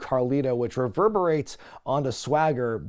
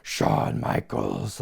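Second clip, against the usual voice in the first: much lower, slow, muffled villain boom; funny Shockmaster voice; 14 semitones lower, 4 dB quieter, much slower than usual. Retold or imitated. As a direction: drag the names out deep and slow like a masked villain. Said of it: "he talked in this really funny voice".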